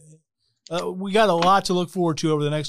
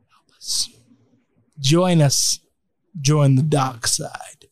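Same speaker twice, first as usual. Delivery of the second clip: low haunting voice; 6 semitones lower, slower than usual. Acted. Creepy call to join the dark side.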